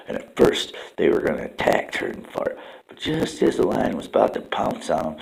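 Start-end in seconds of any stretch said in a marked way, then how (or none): none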